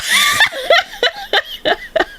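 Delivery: high-pitched helpless laughter; real giggling fit. Real, not acted. Storyteller laughing at the breathing machine story, high giggles. Laughing at her own impression.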